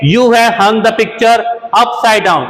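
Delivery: slow, careful teacher read; slow first take of the example; slow model sentence. Shown as a demonstration. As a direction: read the example slowly once. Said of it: "next देखते हैं".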